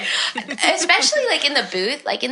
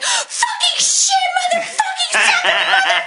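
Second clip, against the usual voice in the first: shrieked mock rage after flubbing a line; frustrated outburst; 4 dB louder than usual. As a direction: blow up at yourself in the booth. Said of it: "I'm like".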